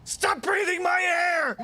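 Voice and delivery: drawn-out, very high, loud scream; slow, full-force shriek of rage; frenzied scream. Acted. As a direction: stretch every word in a crazed yell. Acted frenzy over air.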